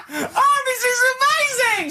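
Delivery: shrieking with laughter; almost crying with laughter; high laughing shout. Real, not acted. Laughing at Carl's story.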